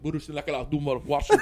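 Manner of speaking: improvised fake-Russian patter; Russian gibberish. Actor bluffing at an audition, Russian gibberish. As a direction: bluff confidently in made-up Russian. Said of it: "I started doing Russian gibberish".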